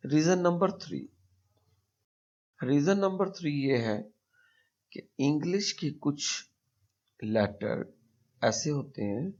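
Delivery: ordinary talk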